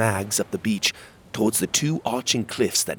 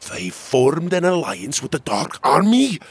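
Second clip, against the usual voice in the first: louder, higher, incredulous question; outraged disbelief; 8 semitones higher, 5 dB louder than usual. Acted. Prince learning of the treaty, outraged. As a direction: rise to a loud peak at the end.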